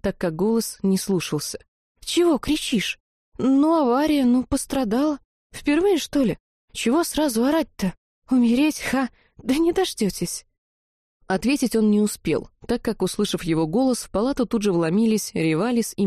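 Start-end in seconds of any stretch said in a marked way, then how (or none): 2.0-10.5 higher, lightly voiced heroine brushing off the fuss; young girl scoffing at her own death; weak indignant girl. A narrator's reading. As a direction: play it off with cheeky bravado. Kira voiced, weak but indignant.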